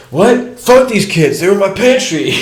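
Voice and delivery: high, loud mock rant with a laugh; mock furious. Drunk frat leader ranting at the homie about his pantry, mock furious. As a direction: ham up the raging frat guy.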